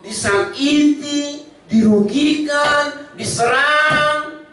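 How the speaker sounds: high, slowing litany; a hint of chant at the end of the list; preaching list. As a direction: draw out every trial, one by one. Preaching list builds slowly.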